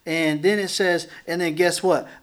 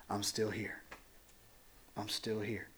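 much softer, lower, gentle voice of God; softly reassure, almost hushed; tender whisper-soft; 7 semitones lower, 14 dB quieter than usual. Retold or imitated.

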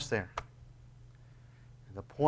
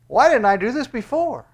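excited realization; 11 semitones higher, 11 dB louder than usual. Retold or imitated. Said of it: "you're gonna say".

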